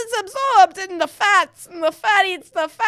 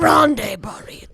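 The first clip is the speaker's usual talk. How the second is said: mock Spanish whisper; 2 semitones lower than usual.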